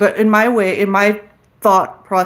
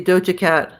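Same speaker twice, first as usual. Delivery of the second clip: ordinary delivery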